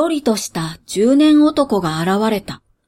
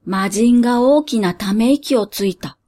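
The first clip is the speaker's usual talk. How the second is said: plain reading voice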